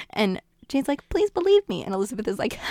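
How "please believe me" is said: high, louder earnest plea; pleading Jane. Retold or imitated. Jane insisting to Elizabeth they're just friends, high plea. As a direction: beg to be believed, pitched way up.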